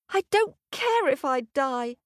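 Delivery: a little bird's defiant cry. A narrator's reading. Hummingbird answering the eagle god, a mild earnest cry. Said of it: "cried the bird".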